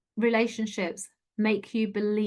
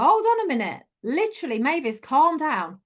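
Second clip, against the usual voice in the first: higher, louder exasperated rebuke; telling off out loud; 6 semitones higher, 4 dB louder than usual. Retold or imitated. Speaker talking back to the nagging voice in her head, exasperated. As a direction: rein someone in, pitch jumping up then easing down. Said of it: "I talk out loud to her".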